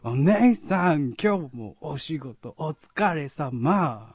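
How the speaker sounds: high, loud, stretched cartoon voice; Nyanchu impression. Performer retrying the greeting to big sister, Nyanchu impression. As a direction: bellow each phrase slowly with long endings. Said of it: "なんかそんな感じ".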